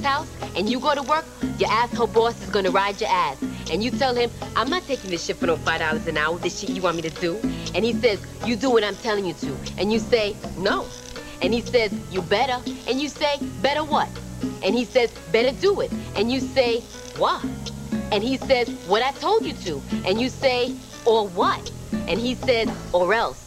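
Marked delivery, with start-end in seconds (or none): 4.6-7.4 low-pitched, fed-up worker talking back; defiant refusal. Acted. Worker tells boss off over low pay, defiant. 22.9-23.4 low, final warning; threatening boss. Acted. Boss warns worker in an argument, threatening.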